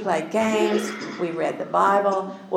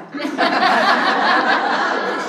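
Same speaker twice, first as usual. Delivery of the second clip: big, high burst of real laughter; loud laugh; 12 semitones higher, 5 dB louder than usual. Real, not acted. Laughs at grandson's punishment.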